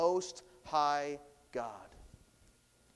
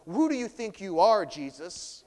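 defiant demon; 5 semitones higher, 8 dB louder than usual. Shown as a demonstration. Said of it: "it's hard to tell from this first tone".

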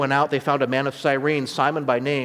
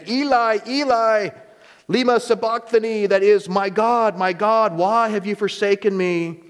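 anguished cry, pitched high and louder; 7 semitones higher, 3 dB louder than usual. A narrator's reading. Jesus crying out to God from the cross, loud anguish. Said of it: "cried out with a loud voice".

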